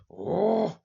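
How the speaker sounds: high, loud "oh!"; exaggerated outcry from a film scene; mock cry. Acting out movie character's cry.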